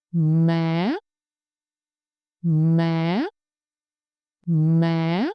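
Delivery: MÁ low, then swooping sharply up; rising tone. Shown as a demonstration. Teacher models for repetition, each MÁ rising from low. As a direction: lift the end of each MÁ steeply. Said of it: "start low and go up slowly and sharply".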